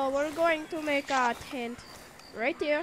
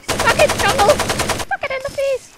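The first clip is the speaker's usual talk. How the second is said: high, loud gloating cry; triumphant taunt; 7 semitones higher, 7 dB louder than usual. Pretend. Player taunting the jungle after surviving, gloating. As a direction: crow over a beaten enemy.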